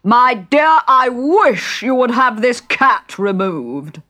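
loud, high, slow kingly call; drawn-out royal complaint. Acted. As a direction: call pompously across to the queen. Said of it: "looked round for the Queen and called".

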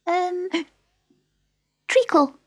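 very high, hesitant squeak; fumble, then squeak the answer; Dormouse. A narrator's reading. The Dormouse answering Alice, high and hesitant.

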